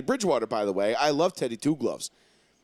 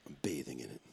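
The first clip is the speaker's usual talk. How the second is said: smug gloat; 12 semitones lower, 11 dB quieter, much faster than usual. Acted. Gloating over Cam Newton call.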